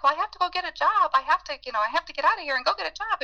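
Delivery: retold self defending the job, pitch up and hurried; flustered protest. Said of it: "And I was like".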